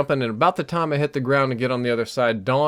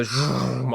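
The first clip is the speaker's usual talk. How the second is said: imitated jet zooming overhead; a long rushing zoom noise; plane whoosh. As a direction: make the noise of a passing plane. Imitates planes flying over.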